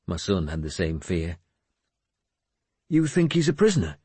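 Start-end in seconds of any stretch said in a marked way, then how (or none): 2.9-4.0 high, quick question; worried son. Acted. Son fears his friend is a prisoner, worried. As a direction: ask it quickly, pitched up with concern.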